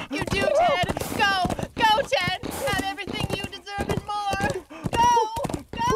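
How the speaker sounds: shouted urging. Acted. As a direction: drive the horse on with a yell.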